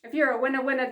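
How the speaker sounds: ordinary talk, nothing marked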